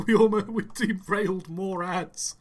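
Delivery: excited. Real, not acted. After the near crash.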